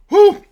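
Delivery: high loud yelp from the heat; sudden burning-mouth woo; pained whoop. Real, not acted. Spicy candy burning his mouth.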